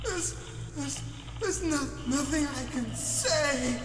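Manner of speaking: terrified victim. Acted. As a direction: stammer in terror. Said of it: "voice acting of the, uh, victim".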